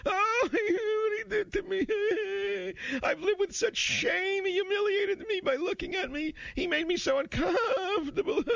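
hammed-up sobbing in a very high falsetto; mock crying. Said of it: "crying with tissues on".

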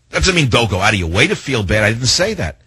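normal talking voice

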